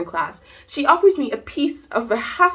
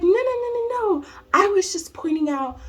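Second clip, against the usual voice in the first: very high string of no's, easing down; imitated flustered teacher; 11 semitones higher than usual. Teacher excusing her remark to student, high flustered imitation. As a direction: backpedal in a high mock voice.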